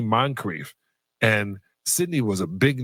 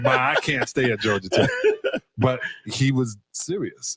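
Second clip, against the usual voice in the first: normal talking voice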